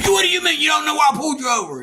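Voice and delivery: imitating the cop shouting at him. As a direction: bark it like an angry cop. Cop shouts in retold stop.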